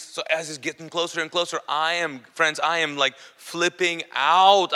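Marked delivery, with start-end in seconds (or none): 3.0-4.7 very slow, loud stretched words; drawn-out mock panic. Telling friends as the demon nears, stretched mock freak-out. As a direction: draw it out for comic emphasis. Retelling panic with comic emphasis.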